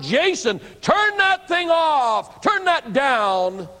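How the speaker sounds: high, slow shout down the house; a father's retold shout, drawn out high; shouted call. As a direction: yell for the son over the music. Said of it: "and I shouted".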